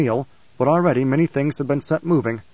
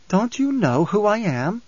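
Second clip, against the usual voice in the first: high, slow, lilting question; sweet youthful voice; 7 semitones higher than usual. A narrator's reading. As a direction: speak sweetly as a young girl. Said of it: "the sweet and lilting voice".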